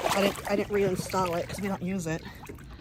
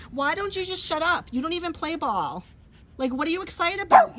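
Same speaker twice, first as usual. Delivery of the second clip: high, mock-exasperated scolding of the dog; 8 semitones higher than usual. Real, not acted. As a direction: tell off the yapping dog in a raised playful voice.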